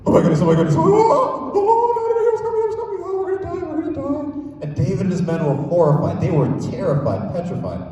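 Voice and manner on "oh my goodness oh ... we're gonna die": high, squealing fake terror; hammed-up scared soldier, much higher pitch; frightened cry pitched far up; mock panic. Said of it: "what is going through David's mind".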